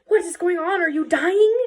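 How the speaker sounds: high, loud, dramatic concern for a dearest wife; hammed-up worried husband; alarmed husband. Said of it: "her husband's like".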